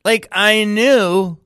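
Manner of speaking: elated realisation. Real, not acted. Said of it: "realisation, slow and loud".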